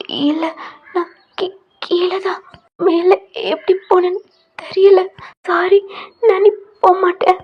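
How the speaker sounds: high, tearful pleading, loud and sobbing; crying. Acted. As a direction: choke the words out through tears. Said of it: "ஒரு மாதிரி அழுகுறீங்க". (அழுதுகொண்டே உயர்ந்த குரலில் பேசுவது)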